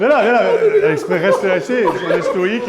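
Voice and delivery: talking through laughter; giggling while giving orders; laughing. Real, not acted. Laughing while the guest struggles.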